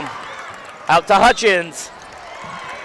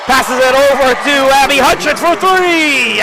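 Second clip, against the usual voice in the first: louder, higher, slow build for three; excited call; 8 semitones higher, 7 dB louder, slower than usual. Real, not acted. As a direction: call the three-point shot big.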